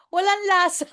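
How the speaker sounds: put-on high, laughing old lady complaint; mock the grandma's complaint; a hammed-up old woman grumbling; mimicking nanay. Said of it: "Reklamo siya".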